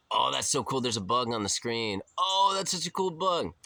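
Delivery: high, bright gushing over a bug; over-the-top amazement at a bug; mock gushing. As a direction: gush like it's the coolest thing ever. Mocking man's excited reaction.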